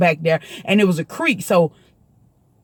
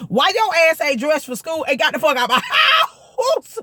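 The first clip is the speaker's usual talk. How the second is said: mock mama cussing; 7 semitones higher than usual.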